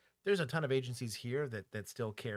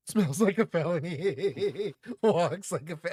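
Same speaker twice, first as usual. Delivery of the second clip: laughing; 6 semitones higher, 10 dB louder, slower than usual. Real, not acted. Joking wordplay with laughs.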